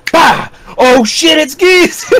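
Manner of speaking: high-pitched mock shout of recognition; excited reaction. Fan retelling the moment of hearing Geese's voice clip, excited shout. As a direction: act out a sudden, thrilled realization, pitch shooting up.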